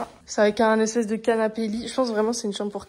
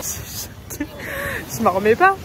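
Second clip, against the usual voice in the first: loud, stunned exclamation; excited disbelief; 9 semitones higher, 4 dB louder than usual. Real, not acted. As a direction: blurt it out in disbelief. Sandstorm, stunned after laughing.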